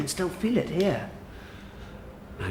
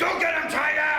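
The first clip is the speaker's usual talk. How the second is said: rallying shout. Acted. Coach pumping him up.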